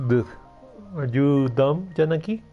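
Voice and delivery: ordinary delivery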